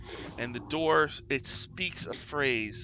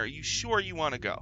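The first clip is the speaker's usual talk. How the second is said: usual speaking voice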